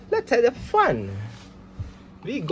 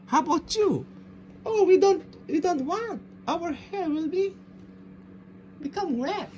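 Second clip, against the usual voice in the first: high-pitched doll voice; little troll character; 10 semitones higher than usual. Pretend. Trolls who don't want wet hair, high pretend voice. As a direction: play the toy troll, pitched way up.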